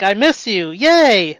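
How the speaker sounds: loud bright cheer at the end; loud happy cheer; cheery yay. Retold or imitated. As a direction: turn missing her into a cheer. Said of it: "Or like".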